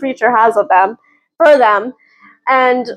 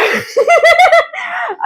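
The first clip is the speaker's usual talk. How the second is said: laugh. Real, not acted. Delighted by the book.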